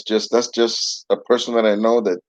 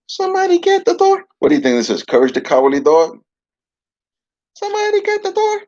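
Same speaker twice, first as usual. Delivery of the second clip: a bossy dummy hollering for someone; puppet voice. Acted. Puppet calls for the door.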